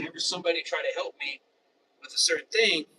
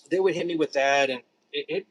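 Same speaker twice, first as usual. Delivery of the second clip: normal talking voice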